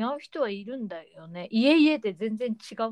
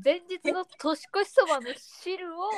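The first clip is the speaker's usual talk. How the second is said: incredulous surprise; 6 semitones higher than usual. Real, not acted. Disbelief at broth reuse.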